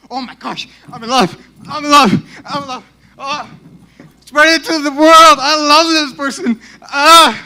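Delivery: high, shouted swooning; giddy mock declaration of love, building to a yell; mock lovestruck raving. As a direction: shout it to the world, hammed-up. Mocking being madly in love.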